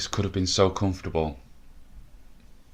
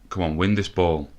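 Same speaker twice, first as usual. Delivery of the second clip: usual speaking voice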